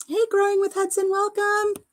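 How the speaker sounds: high, held-up singsong greeting; called-out welcome. Real, not acted. Calls out welcome to arriving viewer.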